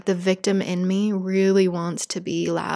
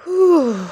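1 loud exhale; 9 semitones higher, 8 dB louder than usual. Real, not acted. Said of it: "release after demo".

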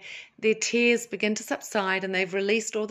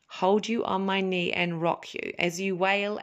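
ordinary delivery

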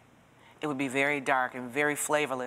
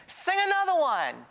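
much higher mock call from the back of the room; called-out request; 14 semitones higher than usual. Bar patron calls a request to the stage, called out high. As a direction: sing out the request like a heckler in a bar. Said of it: "somebody from the back of the room said".

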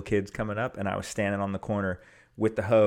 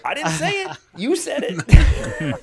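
much higher, louder playful denial; mock protest; 9 dB louder, slower than usual. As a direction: protest your innocence in a hammed-up squeal. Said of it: "And I would be like".

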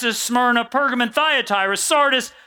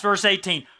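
usual speaking voice

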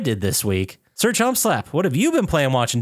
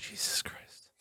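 barely audible, voiceless whisper; awed whisper; 18 dB quieter, slower than usual. Real, not acted. Co-host reacting to an hour-long speed run, whispered disbelief. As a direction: whisper it under your breath in disbelief.

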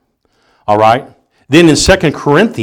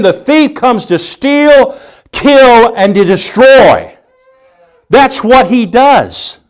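high, urgent pulpit cry; full-force preaching; 12 semitones higher, 4 dB louder than usual. Real, not acted. Preacher warning Christians they are in a fight, impassioned. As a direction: drive each warning home, pitch held high.

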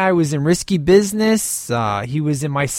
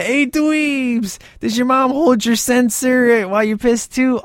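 much higher put-on sneering voice; mocking punk voice; 8 semitones higher than usual. Movie punks taunt the nerds, in a high put-on sneer. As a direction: jeer like a teen punk in a car. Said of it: "just the way that they say that".

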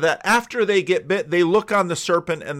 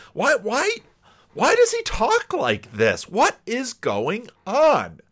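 high-pitched acted bafflement; mock-exasperated, high and emphatic; incredulous questioning; 6 semitones higher, slower than usual. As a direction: ask it in exaggerated disbelief, higher and drawn out at the end. Acting out the baffled, offended teachers.

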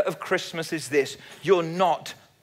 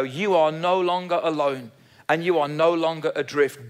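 ordinary talk, nothing marked

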